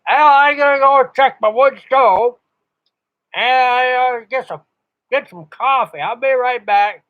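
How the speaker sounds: high, loud mock voice of an old operator signing off; imitating rag chewer on air. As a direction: play the chatty old ham stepping away. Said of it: "you sit there and".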